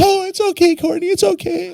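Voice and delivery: put-on very high, sing-song soothing of a toddler; imitating dad soothing. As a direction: mimic grandpa cooing comfort to a little girl. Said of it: "He's like, he runs over there".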